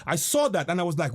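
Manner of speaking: ordinary talk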